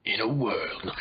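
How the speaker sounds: mock trailer voice-over; movie trailer announcer. Co-host joking about a new movie, mock trailer voice. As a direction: intone it like a film trailer.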